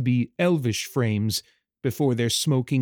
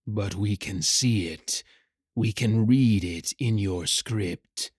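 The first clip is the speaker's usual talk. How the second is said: low, slowing creature voice; ominous shadow rider; 3 semitones lower than usual. Acted. Dark rider answers the frightened hatchling's plea, ominous. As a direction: loom over the listener with dark, measured words.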